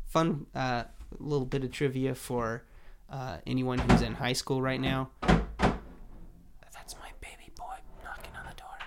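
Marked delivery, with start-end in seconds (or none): 5.2-8.7 hushed aside whisper. Real, not acted. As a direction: whisper it under your breath. Aside about child at door.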